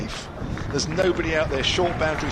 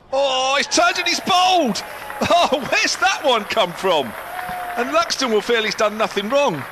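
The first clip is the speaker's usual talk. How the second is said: much higher and louder, laughing in surprise; excited wicket call; 10 semitones higher, 3 dB louder than usual. Real, not acted. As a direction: shout the bowled call, amazed.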